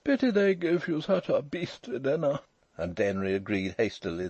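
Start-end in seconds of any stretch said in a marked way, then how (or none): 0.0-2.4 high, drawling, overbearing fellow; languid toff. A narrator's reading. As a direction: complain with languid superiority. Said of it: "the eyeglass agreed languidly".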